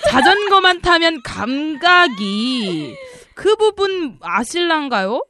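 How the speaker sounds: usual speaking voice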